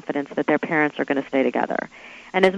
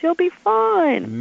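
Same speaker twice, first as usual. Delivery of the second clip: imitated chirpy reassurance, high and drawn out; breezy reassurance; 12 semitones higher, much slower than usual. Retelling the pitch to face hostile women, breezy mock reassurance. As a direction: stretch it high, as if waving off the worry.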